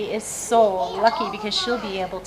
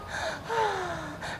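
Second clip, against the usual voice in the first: sharp gasp; 7 semitones higher, 11 dB quieter than usual. Acted. Gasp reading the story.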